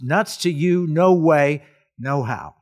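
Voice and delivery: emphatic, punchy mock defiance; defiant refusal. Spelling out a defiant reply.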